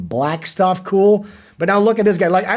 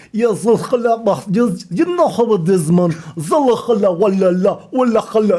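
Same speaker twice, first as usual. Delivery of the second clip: imitating street slang; 2 semitones higher than usual.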